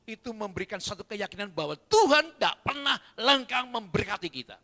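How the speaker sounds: full-force preaching. Acted. Pastor proclaiming blessing to the congregation, loud and high.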